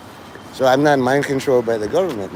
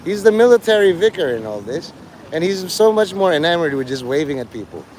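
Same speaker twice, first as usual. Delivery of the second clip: usual speaking voice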